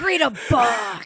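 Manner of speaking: hammed-up exasperated order; mock scolding. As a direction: scold playfully, stretching the words. Acting out the host's comeback.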